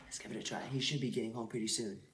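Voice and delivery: very soft, low hushed voice; hushed secret vlog. Acted. Prankster whispering plans to scare his roommate, hushed. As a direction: keep it down, confiding to the camera.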